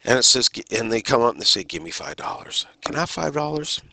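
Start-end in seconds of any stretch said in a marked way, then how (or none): 1.6-2.6 low, soft panhandler's ask; soft request. Shown as a demonstration. Panhandler comes up asking passersby for money, soft request. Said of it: "depending on the inflection of the voice". 2.8-3.8 higher, rising polite request; polite ask. Shown as a demonstration. Panhandler asking someone for spare money, polite ask. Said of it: "depending on the inflection of the voice".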